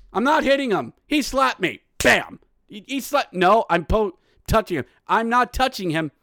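put-on high, indignant child protesting; squabbling kid. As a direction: act out a frantic sibling denial. Acted quarrel between brothers.

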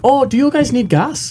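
put-on Canadian accent. Mocking polite Canadians.

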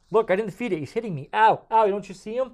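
mock panicked cries. Acted merchant attacked by spirit.

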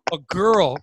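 ordinary delivery

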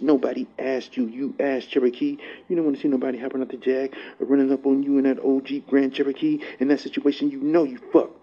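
normal talking voice

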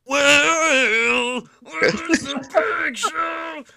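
high, slow, loud drawn-out shout; mock Jeff Hardy shout; mock wrestling promo. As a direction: stretch it out and belt it like a promo. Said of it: "number one is".

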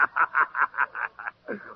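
low scornful chuckle; mocking laugh. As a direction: laugh at the boy. Dealer mocks the donkey.

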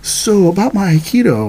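high, eager mock gratitude; hammed-up keen student. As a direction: fish for the teacher's attention. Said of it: "And then you go".

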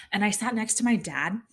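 usual speaking voice

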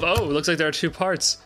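normal talking voice

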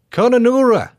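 put-on high, loud Australian accent on the town name. Asked by cohost for the town name, Australian accent. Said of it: "in an Australian accent for me".

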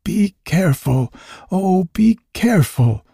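high-pitched anxious little plea; entreating. A narrator's reading. Elf pleading as she removes the thorn, high entreaty. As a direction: plead with her to take care. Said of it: "entreated the little man".

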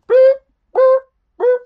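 very high, loud truck backing-up beeps; mock backing-up alarm; imitated reverse beeper.

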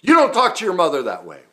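full-volume acted fury, pitched far up; explosive retold yell; losing it. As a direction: imitate an enraged father screaming at his son. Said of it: "he just lost it".

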